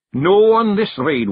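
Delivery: loud, slow "No"; firm. Acted. Rob refuses to lead the raid, firm and loud. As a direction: put your foot down.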